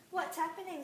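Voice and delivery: frightened scream. Acted. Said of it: "screamed a frightened girl".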